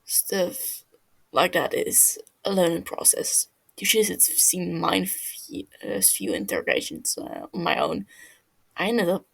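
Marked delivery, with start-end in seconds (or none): none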